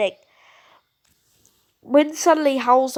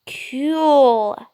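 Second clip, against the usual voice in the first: whisper; 3 dB louder than usual. Acted. Kid whispering in awe.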